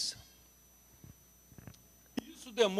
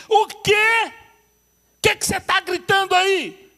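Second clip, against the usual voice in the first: irritated shout; 11 semitones higher, 10 dB louder than usual. Retold or imitated. Wife snapping back from phone.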